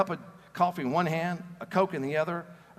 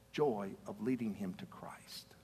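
hushed; 8 semitones lower, 9 dB quieter, slower than usual. A narrator's reading. Tender close of the story.

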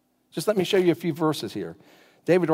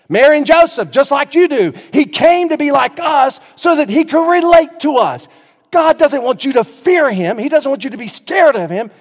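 high, loud, impassioned pulpit cry; full-force preaching; 10 semitones higher, 9 dB louder than usual. Real, not acted. Preacher insisting God came to be like us, fervent. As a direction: drive it home to the whole congregation at full volume.

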